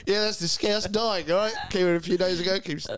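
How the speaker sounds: lightly voiced locals, laughing; a hint of gossiping Aussies, giggling; mock locals. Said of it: "Can go to Australia".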